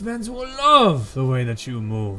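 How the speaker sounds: flattering rich man. Acted. Rich man flattering a woman about her services, effusive. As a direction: lay on the flattery, voice lifted and swooping. Game master voices a flattering procurer.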